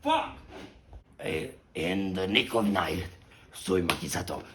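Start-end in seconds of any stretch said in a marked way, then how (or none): 0.0-0.4 frustrated shout. Real, not acted. Outburst after cut.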